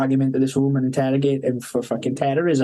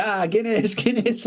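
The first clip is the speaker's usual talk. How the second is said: delighted exclaim; 7 semitones higher, slower than usual. Retold or imitated. Belgian police delighted by the Guinness.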